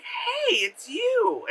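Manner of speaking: very high, drawn-out hello; mock coy greeting. Voicing the princess greeting the prince, high mock greeting. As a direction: pitch it up into a sugary squeal.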